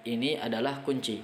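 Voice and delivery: ordinary talk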